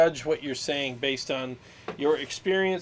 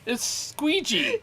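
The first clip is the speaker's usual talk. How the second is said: slow, higher, laughing through it; joking mispronunciation; 10 semitones higher, much slower than usual. Acted. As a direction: stretch the silly name out, laughing.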